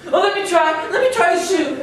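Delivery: put-on high, eager pleading girl voice; preening stepsister begging; vain stepsister. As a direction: beg sweetly to try it first.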